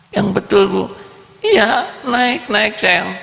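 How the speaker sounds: high excited voices; bright eager exchange; child and mother. Acted. Boy asks if it's true, mother says get in, high voices.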